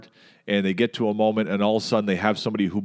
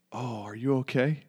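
soft, caring check-in; suddenly concerned; 3 semitones higher, 6 dB quieter than usual. Retold or imitated. Irate driver to the crying woman, suddenly concerned. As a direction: drop the anger and ask softly, imitating sudden concern. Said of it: "He went from irate to".

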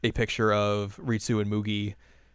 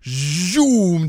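zoom effect; 6 semitones higher, 7 dB louder than usual. Retold or imitated. Sound effect in the retelling.

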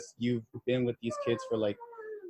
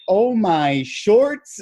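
slow drawn-out high exclamation, louder; oh my shorts; 8 semitones higher, 7 dB louder, much slower than usual. Retold or imitated. Retelling a student's greeting, a slow drawn-out mock shock. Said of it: "he's like".

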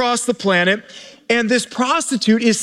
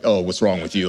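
much lower, flat sneering voice; lower, mocking finger-wagging tone; mock judgmental voice; 3 dB quieter, faster than usual. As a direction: play a self-righteous scold, lightly.

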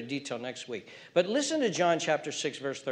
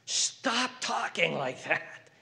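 ordinary delivery

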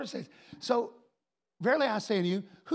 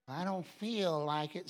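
slow, low mock reluctance; lightly voiced unwilling churchgoer; reluctant believer; 4 semitones lower, 5 dB quieter than usual. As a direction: drag it out like someone who won't.

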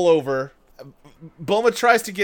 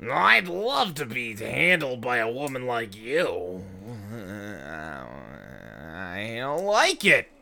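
slow put-on creepy pig voice; lecherous cartoon pig, drawn out; Oolong voice; 6 semitones lower, 4 dB quieter, slower than usual. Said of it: "Oolong is like".

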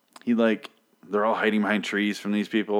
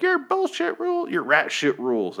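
much higher put-on voice mocking her rant; 11 semitones higher than usual. Recapper mocks her yelling at the men, much higher mock voice. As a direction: squeak out her angry line in a mock voice. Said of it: "she's like".

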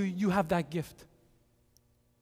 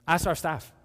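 usual speaking voice

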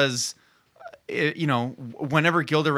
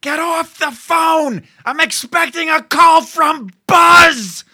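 high, drawn-out hammy holler building louder; daughter yelling; 12 semitones higher, 10 dB louder, slower than usual. Acted. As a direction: yell it slowly, blasting the last word. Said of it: "is yelling".

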